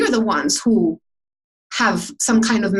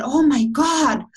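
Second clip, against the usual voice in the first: high, slow, delighted gush over the boots; a street woman gushing over boots, high and slow; endearing gush; 6 semitones higher, slower than usual. Retold or imitated. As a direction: coo it like a charmed stranger. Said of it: "so endearing the way she said it".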